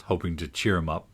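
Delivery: plain reading voice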